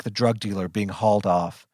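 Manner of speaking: ordinary delivery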